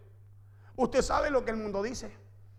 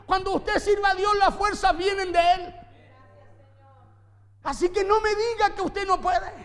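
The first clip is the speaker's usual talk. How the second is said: loud, driving sermon delivery; forceful preaching; 7 semitones higher, 7 dB louder than usual. Acted. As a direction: preach it hard to the congregation.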